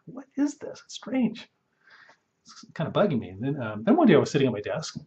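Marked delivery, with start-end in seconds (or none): 0.0-1.5 high, half-breathed puzzlement; puzzled thought. Retold or imitated. Retelling his own reaction to swollen glands, mild bafflement. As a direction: ask yourself lightly, mildly baffled. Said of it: "And I'm like".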